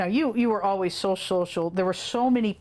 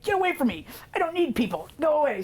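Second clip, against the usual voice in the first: high-pitched mock refusal; imitated defiant toddler; 8 semitones higher than usual. Withdrawn little girl to visiting family friend, defiant. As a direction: imitate a little girl pushing people away.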